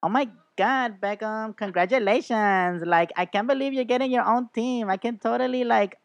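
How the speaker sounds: high, gushing fake congratulations; gushing celebrity voice. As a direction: squeal it like a starstruck celebrity. Mock celebrity congratulating Beckham.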